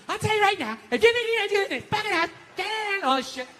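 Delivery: high-pitched mock tirade; scolding wife. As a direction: imitate a wife tearing into her husband. Wife scolding him, acted out.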